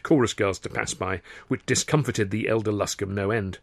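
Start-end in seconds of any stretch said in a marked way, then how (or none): none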